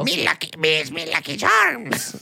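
slow, drawn-out leprechaun voice; badly faked Irish goblin. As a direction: do a cartoon leprechaun, stretching the words. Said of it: "like the leprechaun".

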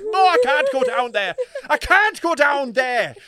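loud, high Irish wail, slowing down; hammed-up terrified Irish traveller; scared Irish traveller. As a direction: plead in panic with an Irish accent. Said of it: "they're shit scared".

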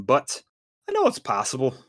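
normal talking voice

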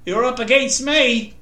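mild bully's gloat; sneering taunt. A narrator's reading. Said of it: "he sneered".

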